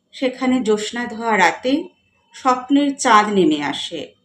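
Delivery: measured recital with lifted line openings and falling ends; poetry recitation. Acted. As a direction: recite the verse with feeling, pausing between phrases. Recites own poem about a dreaming girl. (কবিতা আবৃত্তি)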